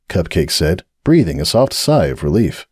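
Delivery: ordinary delivery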